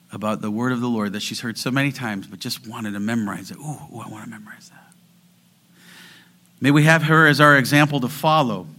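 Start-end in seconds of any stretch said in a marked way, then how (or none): 3.6-4.9 quick eager whisper; excited whisper. Retold or imitated. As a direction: whisper it like a delighted old lady. Voicing the eager old mother.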